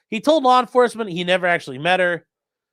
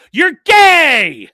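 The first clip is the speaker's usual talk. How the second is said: high, loud taunting yell; taunting shout; 10 semitones higher, 12 dB louder than usual. Retold or imitated. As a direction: shout it back like a kid's taunt. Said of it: "I'm like".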